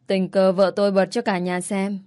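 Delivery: ordinary delivery, nothing marked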